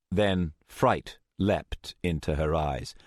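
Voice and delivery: ordinary delivery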